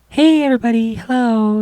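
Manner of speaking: very high, loud bubbly vlogger greeting. Retold or imitated. Mocking a cartoon vlogger greeting her viewers, high and bubbly. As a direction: squeal it like a perky vlogger girl. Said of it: "fictional YouTuber vlogger".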